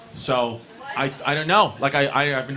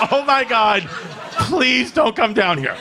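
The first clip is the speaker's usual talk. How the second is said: high, loud, laughing begging; laughing, pleading; panicked plea; 7 semitones higher, 3 dB louder than usual. Retold or imitated. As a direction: beg him not to come. Begging dad not to come.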